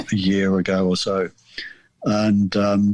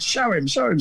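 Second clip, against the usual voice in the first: mum repeating the order, pitched much higher; mum insisting; 10 semitones higher than usual. Retold or imitated. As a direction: push him to do it, high. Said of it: "She said".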